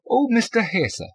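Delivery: high, loud "Hesse", copying his pronunciation; Hesse pronounced his way. A narrator's reading. Woman recognising his name, "Hesse" said his way. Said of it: "pronouncing it that way".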